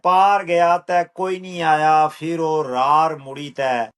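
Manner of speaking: rhythmic, measured verse with a returning refrain; poetry recital. Acted. Poet reciting a poem on migration, measured rhythmic recital. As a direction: recite it unhurried, falling on each refrain.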